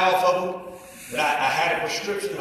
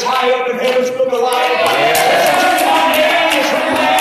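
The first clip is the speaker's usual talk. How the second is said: shouted high, preacher at full cry; full-force preaching; 11 semitones higher, 14 dB louder than usual. Acted. Preacher shouting his faith to the church, full force. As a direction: ring it out to the congregation.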